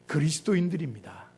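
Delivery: normal talking voice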